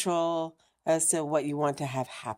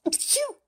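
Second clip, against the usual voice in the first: real sneeze. Real, not acted. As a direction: let a sneeze burst out.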